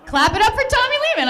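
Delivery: high, loud and fast hype call; rally the crowd like an MC; hype cheer. Real, not acted. Calling the audience to clap for Tommy, loud hype.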